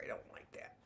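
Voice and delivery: unvoiced whisper to herself; hushed whispered complaint; whispered aside. Real, not acted. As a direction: whisper it as a private grumble. Aside about her video.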